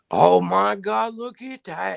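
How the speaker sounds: high, slow delighted gasp; drawn out as if dazzled by food; real delight at the food. Real, not acted. Reacting to hot dogs shown.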